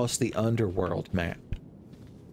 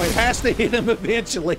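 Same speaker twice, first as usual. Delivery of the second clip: much higher and louder, pleading; desperate shout; 9 semitones higher, 5 dB louder than usual. Real, not acted. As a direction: yell it like your hope depends on it. Repeats line, loud then calm.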